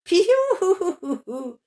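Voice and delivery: long, very high, loud chick cry; chick peep. Acted. Poussine rit du pitre. (le poussin crie piou, très aigu)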